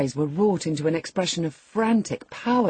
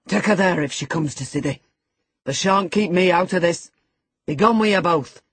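put-on a raging young man, louder and harsh; angry outburst; 3 dB louder than usual. A narrator's reading. Earnshaw driving Linton and Cathy out, angry and loud. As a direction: throw them out in a fury.

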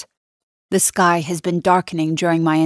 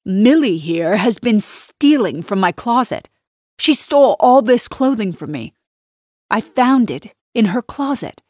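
higher and louder, outraged charge; loud denunciation; 5 semitones higher, 5 dB louder than usual. Acted. Nina telling her husband the maid stole, loud and high. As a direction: accuse her in front of everyone. Nina denouncing Millie to Andrew.

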